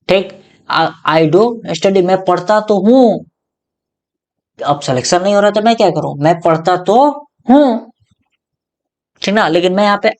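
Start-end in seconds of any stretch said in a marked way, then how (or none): 0.7-3.2 "do" hit hard in "I do study", slow; emphatic do, slowed; stress on do. Shown as a demonstration. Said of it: "जोर डाल के बोल रहे हो". 6.2-7.9 insistent rising हूँ; stress on हूँ. Shown as a demonstration. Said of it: "जोर डाल के बोल रहे हो".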